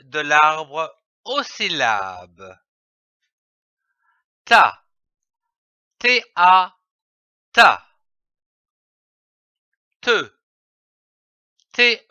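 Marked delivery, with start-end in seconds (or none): 4.4-7.8 ta read louder, the syllable then its letters T A; loud clear syllable drill; syllable demo. Shown as a demonstration. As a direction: sound out ta firmly for young readers. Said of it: "Je lis une syllabe".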